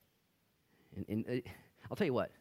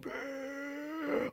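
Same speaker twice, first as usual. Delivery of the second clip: mock gasp. Mimics crowd gasping.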